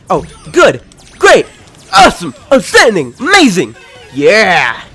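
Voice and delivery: loud, high hype calls; game announcer voice. Retold or imitated. Echoing game announcer praise.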